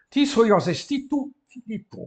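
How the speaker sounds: pergunta grega alta e aguda; pergunta declamada. Shown as a demonstration. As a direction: lance a pergunta à turma. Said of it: "reads Greek question aloud". (o professor lança a pergunta em grego à turma, alto e agudo)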